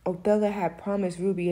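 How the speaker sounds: normal talking voice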